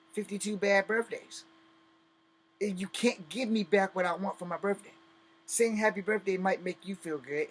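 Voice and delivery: normal talking voice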